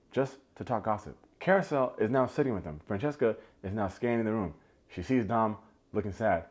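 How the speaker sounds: normal talking voice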